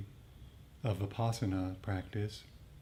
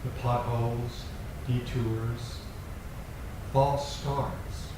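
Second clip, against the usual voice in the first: poem reading; 3 dB louder than usual. Acted. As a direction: let each image land, unhurried. Reads a poem aloud.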